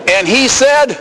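normal talking voice

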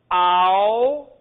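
ow long and loud, as in "how"; vowel demonstration. Shown as a demonstration. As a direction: sound out ow loudly, drawing the glide out. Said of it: "if we would say the word how".

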